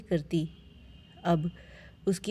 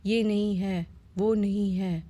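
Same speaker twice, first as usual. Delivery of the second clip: mild mock shout from the mother-in-law; lightly voiced old mother-in-law calling for the bride; 2 semitones higher than usual. A narrator's reading. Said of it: "सास चिल्लाती".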